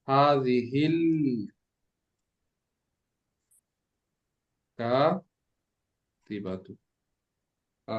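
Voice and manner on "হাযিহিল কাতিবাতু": হাযিহিল drawn out, long pause, then কাতিবাতু in pieces; very slow dictation. Shown as a demonstration. Teacher repeating for students writing it down, very slow dictation.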